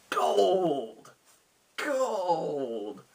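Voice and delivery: high, long drawn-out cry; lord promising riches. Acted. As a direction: stretch the word out like dangling treasure. Lord dangles the reward.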